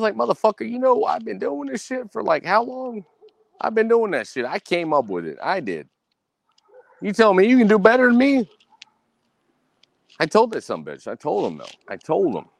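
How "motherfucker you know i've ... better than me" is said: high, excited mock tirade; retold heated rant. Man retelling how he lashed out at a rival, high and excited. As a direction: rant at the rival, all fired up.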